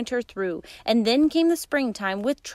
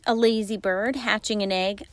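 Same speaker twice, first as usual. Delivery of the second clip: plain reading voice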